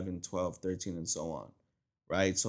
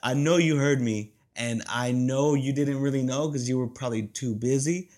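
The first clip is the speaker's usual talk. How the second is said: louder knowing bruh; exasperated; 4 semitones higher, 7 dB louder than usual. Retold or imitated. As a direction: call her out, half laughing. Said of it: "I was like".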